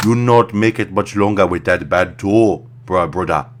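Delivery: loud, slow put-on African accent; lightly voiced African doctor scolding; mock African doctor. Doctor warning [name] about his toe, mock African accent. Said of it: "and the doctor says".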